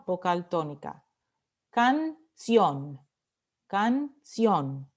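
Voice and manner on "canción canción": "Canción" split into can, CIÓN, stress on the last; syllable by syllable. Shown as a demonstration. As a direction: say "canción" in two separate beats, stressing CIÓN. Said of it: "Última sílaba".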